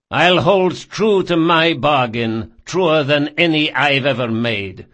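loud, slow commanding crime boss; kappa. A narrator's reading. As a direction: bark the order down like a ruthless boss. Barsavi voiced, loud and slow.

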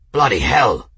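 loud, shocked curse; alarmed oath. A narrator's reading. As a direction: swear aloud at a sudden threat. Shock at seeing enemy marines.

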